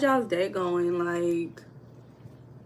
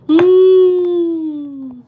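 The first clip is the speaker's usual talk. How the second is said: mock gasp; 9 semitones higher, 13 dB louder than usual. Real, not acted. Viewer comment surprises host.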